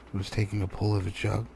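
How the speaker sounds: usual speaking voice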